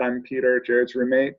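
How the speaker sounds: usual speaking voice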